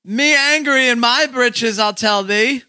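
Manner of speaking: put-on high, drawn-out silly accent passed off as Spanish; theatrical fake Spanish-Australian accent; mock Spanish accent. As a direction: ham up a bogus Spaniard with Aussie-style vowels. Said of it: "a really good Spanish accent by [name]".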